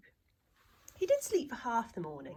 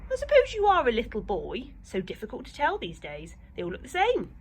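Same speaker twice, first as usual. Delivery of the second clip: put-on old lady; 4 semitones higher, 6 dB louder than usual.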